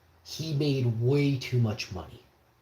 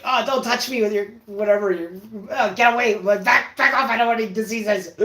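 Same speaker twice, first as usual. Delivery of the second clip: loud, high hammed-up squeal of disgust; mock disgusted recoil; 9 semitones higher, 7 dB louder than usual. Imitating himself shooing the lady away, loud high mock disgust. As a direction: shoo her off, fast on the last line. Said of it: "I'm like".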